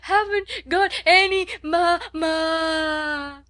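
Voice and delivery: high, loud, slow broken crying; child wailing for her mother; wailing sobs. A narrator's reading. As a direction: wail it between sobs. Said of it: "Lottie wailed more loudly".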